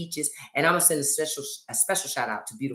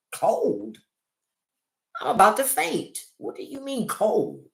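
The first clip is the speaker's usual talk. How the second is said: much higher, exasperated disbelief at "cold"; mock outrage; 7 semitones higher than usual. A hot-flashing wife scoffing at her husband's complaint, higher pitched. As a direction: act stunned he could feel cold.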